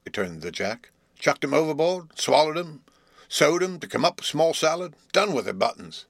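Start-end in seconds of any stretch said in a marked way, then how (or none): 1.2-6.0 higher, scornful muddy-throated voice; mock them through a slushy throat. A narrator's reading. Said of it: "He spoke in a slushy voice".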